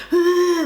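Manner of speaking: short high loud fangirl scream; squealing scream at the stage; mock scream. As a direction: shriek it like an overexcited fan in the audience. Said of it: "like, screaming".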